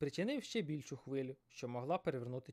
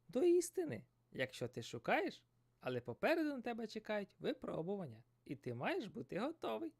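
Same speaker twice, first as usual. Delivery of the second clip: fish voice; 9 semitones higher than usual. Acted. Dori warns of trials.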